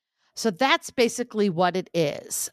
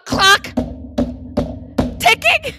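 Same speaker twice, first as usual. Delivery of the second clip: high, loud mock alarm; teasing; 12 semitones higher, 6 dB louder than usual. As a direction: tease her, voice shooting up.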